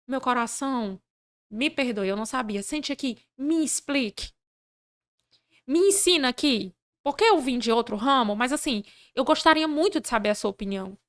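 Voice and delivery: soft, tender request to explain; gentle, humble appeal. Retold or imitated. Leader asking an angry worker to explain, softly conciliatory. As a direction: disarm them softly, with humble sincerity. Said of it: "eu aprendi a dizer assim".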